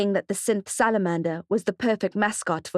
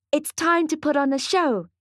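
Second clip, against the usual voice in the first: put-on higher child's voice, eager; little boy; 8 semitones higher than usual. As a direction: speak up high like a small boy. Young boy Mally speaks.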